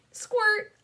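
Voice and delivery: high, loud squirt call; squirt sound effect. Acted. Clown girl playing seltzer at her mirror, high squeaky squirts. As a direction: squeal the word like a squirt bottle. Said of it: "pretended to squirt myself with seltzer".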